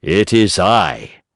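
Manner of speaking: put-on a tired old father at the door; Geppetto's voice. A narrator's reading.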